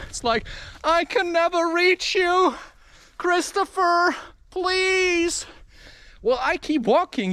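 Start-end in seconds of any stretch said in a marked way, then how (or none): 0.8-5.5 high-pitched theatrical begging; mock desperate plea. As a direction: imitate someone pleading from out of reach. Mimics someone pleading to him.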